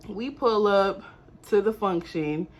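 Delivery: usual speaking voice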